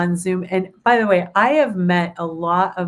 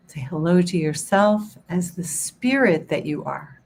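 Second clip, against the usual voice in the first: guided meditation. Acted. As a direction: guide them gently into calm.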